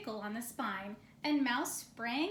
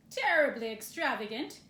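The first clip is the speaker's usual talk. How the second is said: gruff bear voice; 7 dB louder than usual. Acted. Bear grumbling at library books.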